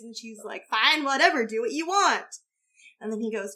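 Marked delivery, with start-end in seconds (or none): 0.7-2.4 loud, higher-pitched mock huff; exasperated mother. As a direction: act out a fed-up mum giving in. Voicing the exasperated mother's reply.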